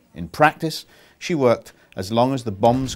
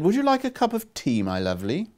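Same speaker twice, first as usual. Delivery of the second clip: a light Welsh lilt, voice lifted high on the offer; friendly Welsh tea lady voice; Welsh accent; 8 semitones higher than usual. A narrator's reading. Welsh woman offering Daisy tea, in a lightly Welsh voice. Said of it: "She recognized the accent as Welsh".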